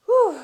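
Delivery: big loud exhale after effort; loud effort whew. Real, not acted. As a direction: blast out a breath of relief.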